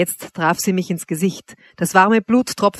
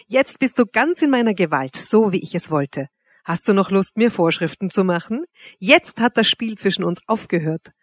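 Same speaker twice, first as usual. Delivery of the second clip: ordinary delivery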